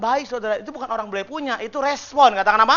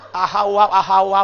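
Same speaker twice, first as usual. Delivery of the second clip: mock response; 9 dB louder than usual. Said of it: "Materi dari mana sih kayak begitu".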